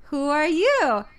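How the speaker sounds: slow, drawn-out mock question; lightly voiced, singsong cartoon question; Shelly May. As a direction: imitate a simple kids' show character. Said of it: "And then Shelly May goes".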